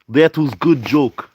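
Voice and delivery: put-on deliberate, comic Russian accent; mock Russian accent. Approving the chat's joke in a mock Russian accent. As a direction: praise it like a hammy Russian. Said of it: "I know how speak Russian accent".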